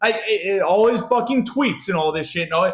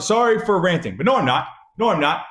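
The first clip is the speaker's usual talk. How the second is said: normal talking voice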